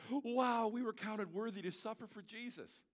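lightly voiced apostles, amazed and delighted; mock amazement. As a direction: play the apostles as thrilled to suffer. Voicing Paul and Silas rejoicing.

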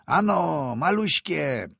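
high, loud yell at the horses; peasant yelling at horses. A narrator's reading. Peasant driver yells at his horses instead of answering, loud. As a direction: holler at the horses over the wind. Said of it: "wrzasnął chłop na konie".

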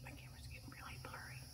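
fast hushed whisper. Real, not acted. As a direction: whisper it quickly. Whispering on ghost hunt.

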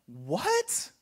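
rising incredulous What? What?; incredulous exclamation. Retold or imitated. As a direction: read the apostle's outrage with disbelief. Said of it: "exclamation point".

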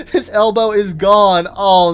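normal talking voice

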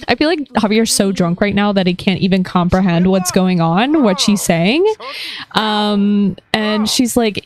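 ordinary talk

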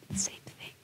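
near-silent whisper; whispered refrain. Real, not acted. Wife tells what happened the next day, whispered. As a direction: let it fall away to a bare whisper.

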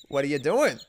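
ordinary delivery

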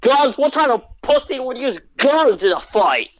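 high, scornful mock-Aussie outburst; Australian accent. Australian friend scorns guns in fights, high Australian accent. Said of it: "I'll do the Australian accent".

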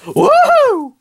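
very high, loud whoop; party whoop. Acted. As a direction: cheer like a wild partygoer. Cat cheering for party.

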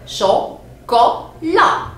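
CHOCOLAT slowly split into three beats; syllable by syllable. Shown as a demonstration. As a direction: say "Chocolat" one syllable at a time. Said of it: "Je compte les syllabes". (« Chocolat » dit lentement, syllabe par syllabe)

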